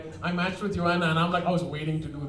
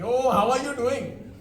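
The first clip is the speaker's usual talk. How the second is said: put-on Indian accent; 6 semitones higher, 5 dB louder, slower than usual. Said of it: "speaking in an Indian accent".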